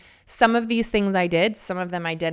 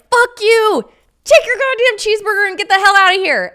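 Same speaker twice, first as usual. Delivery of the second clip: much higher and louder, spitting furious yell; furious shout; 13 semitones higher, 10 dB louder than usual. Retold or imitated. Sick wife screaming at husband over the wrong cheeseburger, furious shout. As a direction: blow up and scream him out of the room.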